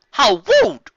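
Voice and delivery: shrill, loud huff of offence; high, loud mock outrage; indignant. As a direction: play it scandalised. Acted indignant character outburst.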